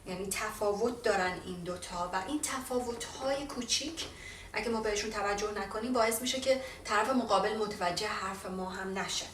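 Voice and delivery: ordinary delivery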